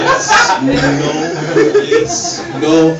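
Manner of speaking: panic, can't decide; flustered dithering. Retold or imitated. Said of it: "I was totally like".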